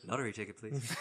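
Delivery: quiet flat deadpan echo. Retold or imitated. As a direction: drop it low and dull, bored. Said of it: "that deadpan delivery of".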